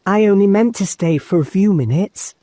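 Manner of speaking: put-on high, polite little girl voice; a girl politely excusing herself; young girl Lucy. A narrator's reading.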